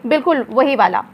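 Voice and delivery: ordinary talk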